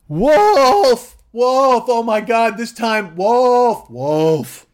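loud, high drawn-out alarm shout; mock-panicked yelling, high and loud; crying wolf. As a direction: holler a false alarm across a meadow. Acting George crying wolf.

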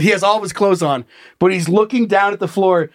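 usual speaking voice

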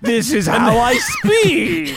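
high, very slow villain drawl with laughter; villainous actor impression. Villain-voiced actor explaining his voice, drawn-out impression. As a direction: answer proudly in the put-on voice. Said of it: "Why are you talking like that?".

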